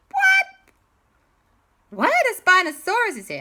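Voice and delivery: high, loud acted disbelief; shocked outcry. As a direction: cry out in shocked surprise. Everyone reacts in shock.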